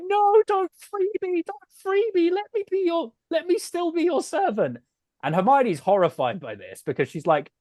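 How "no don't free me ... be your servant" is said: squeaky, much higher elf voice begging; a frantic little elf begging not to be freed; pleading house elf. Retold or imitated.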